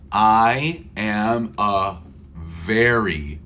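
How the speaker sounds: very said slowly with a clear v, lips on teeth. Shown as a demonstration. Teacher showing very versus berry. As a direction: stretch out very with a buzzing v. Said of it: "not berry".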